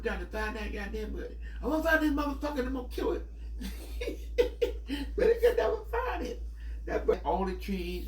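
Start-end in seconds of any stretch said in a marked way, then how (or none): 1.6-3.3 laughing mock threat. Quoting cousin hunting the bird. 5.2-6.4 high voice through laughter; laughing. Real, not acted. Still laughing after the quote.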